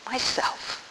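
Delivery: hushed, deep solemn voice; very deep and low. A narrator's reading. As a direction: say "Myself" very softly, as from deep down. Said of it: "very deep and low".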